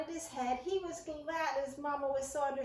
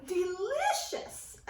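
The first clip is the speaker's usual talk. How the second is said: choked; 5 semitones higher, 4 dB louder, much slower than usual. A narrator's reading. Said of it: "choked Christopher".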